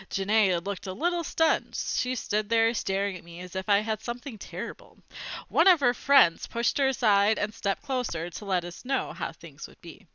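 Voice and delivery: usual speaking voice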